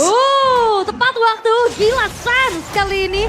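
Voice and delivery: excited. Real, not acted. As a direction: react in disbelief at the play. Co-caster reacting to clutch ult. (seru kagum dengan suara tinggi)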